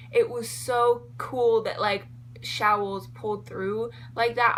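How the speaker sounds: normal talking voice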